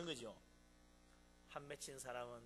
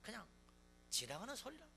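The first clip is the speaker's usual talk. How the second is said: ordinary delivery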